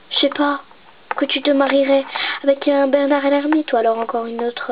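normal talking voice

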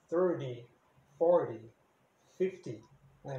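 thirty, forty, fifty each ending in a clear ee vowel; clear final ee sound. Shown as a demonstration. Teacher showing students the final sound, clear ee ending. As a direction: finish forty with a full ee, no dropped ending. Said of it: "que é um som de I mesmo".

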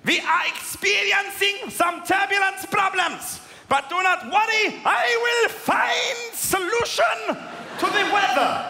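put-on high, slow, loud German-accented PA announcement; confident German captain promising to fix the weather; German pilot accent. German pilot reassuring nervous passengers, high loud mock-German accent. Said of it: "He was like".

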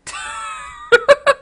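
high-pitched burst of laughter; real laugh. Real, not acted. Player cracks up at the dog in the game, laughing.

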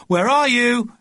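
high, loud call from below. A narrator's reading. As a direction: shout up the stairs. Mother calling upstairs.

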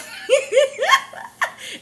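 high, loud burst of laughter; real laugh. Real, not acted. Daughter cracking up at mom's warning. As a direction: cackle at it.

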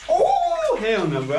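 high, slow, loud cry; shocked yell. Real, not acted. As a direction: yell out at the freezing water. Shock of the ice bath.

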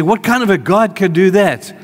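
high, loud, doubting question; mock skeptic asking in disbelief; incredulous challenge. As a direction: throw out the doubter's objection. Skeptic's voiced objection.

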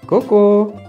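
high, loud, playful ку-ку; playful peekaboo call. Acted. (игривое «ку-ку», звонко и громко)

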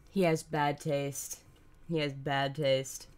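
low, deadpan judgement; mock-grave verdict. Streamer judging friend's dad over a movie, low mock-grave. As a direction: drop low and pronounce it solemnly.

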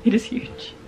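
breathy hushed repeat; awed whisper. Real, not acted. Showing the viewers the huge blanket, awed whisper. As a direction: whisper it in disbelief.

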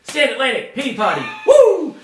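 loud, high hype shout ending in a whoop; hyped announcement. Pretend. Host announcing today's band and song, hyped and loud.

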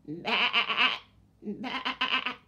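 bleating sheep. Shown as a demonstration. Showing children the sheep's sound, bleating.

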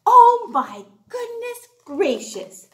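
plain reading voice

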